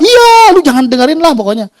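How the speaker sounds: very high, emphatic boss voice; boss insisting. Retold or imitated. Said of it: "dia bilang".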